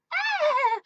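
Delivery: short high mock shriek; mock scream. Mocking the teen girls' screams, high mock shriek. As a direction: squeal it like a scared girl. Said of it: "I'm not talking about".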